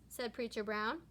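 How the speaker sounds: ordinary delivery, nothing marked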